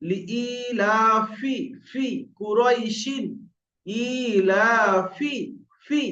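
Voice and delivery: very slow, elongated "লিইলাফি" with teeth-on-lip f; recited fa example. Shown as a demonstration. Teacher recites a verse to show fa, slow drawn-out "ফি". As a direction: stretch the vowels, release air on "ফি". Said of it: "উপরে দাঁত নিচের ঠোঁটের".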